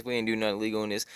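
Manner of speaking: ordinary delivery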